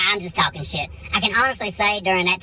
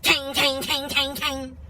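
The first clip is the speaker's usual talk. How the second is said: higher-pitched, ringing metal clangs; imitated hammer clanging; 6 semitones higher, slower than usual. Husband resumes banging on garage door, high imitated clangs. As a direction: mimic stubborn, evenly repeated hammer blows. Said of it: "start beating on this shit some more".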